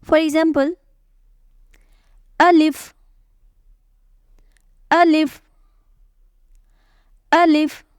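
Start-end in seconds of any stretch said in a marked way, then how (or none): 2.4-7.9 "alif" said short and punched, louder, with no stretch; short clipped alif. Shown as a demonstration. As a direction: snap out "alif" short, no drawing out. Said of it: "read alif and hamza without stretching".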